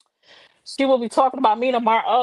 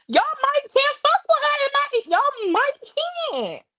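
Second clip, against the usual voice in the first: much higher put-on voice, pushed to the top; E Kane voice. Shown as a demonstration. Streamer cranks up her E Kane voice for chat, very high. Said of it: "in my E Kane voice".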